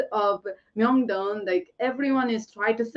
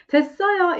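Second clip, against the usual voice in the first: high demo; 9 semitones higher, 4 dB louder, much faster than usual. Shown as a demonstration. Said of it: "is not really like".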